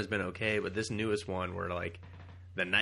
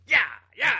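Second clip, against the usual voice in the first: much higher, louder eager cries; mock eager yell; 13 semitones higher, 11 dB louder than usual. Voicing the mutant stabbing the air, high and loud. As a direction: cheer like a stab-happy goon.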